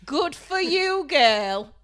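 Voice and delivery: put-on high, loud, slow sassy "Good for you, girl"; over-the-top sassy cheer; sassy catchphrase. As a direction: camp it up, high and drawn out. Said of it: "I will actually say to him".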